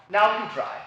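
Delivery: ordinary delivery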